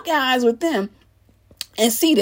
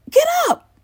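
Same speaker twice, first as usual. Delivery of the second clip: high-pitched exasperated order; exasperated command; 4 dB louder than usual. Acted. Host cutting off an excuse-maker, exasperated. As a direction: cut in sharply, much higher.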